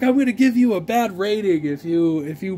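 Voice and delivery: seller's petty threat, sent up; mocking seller threat. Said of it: "He's like".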